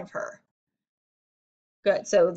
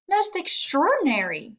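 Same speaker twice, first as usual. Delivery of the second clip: high amazed exclamation; a doctor's astonished cry; exclaiming; 7 semitones higher than usual. A narrator's reading. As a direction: marvel at it, pitch up. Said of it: "he exclaimed".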